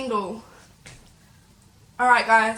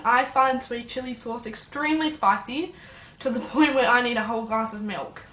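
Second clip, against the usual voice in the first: ordinary talk, nothing marked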